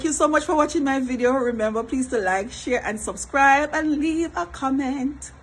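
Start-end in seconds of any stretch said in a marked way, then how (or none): 3.7-5.3 high, slow, stretched-out sign-off; playful sing-song plea. Real, not acted. Vlogger asking viewers for comments, playfully drawn out. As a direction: draw out each word like a teasing request.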